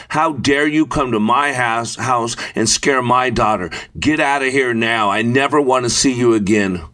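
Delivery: ordinary delivery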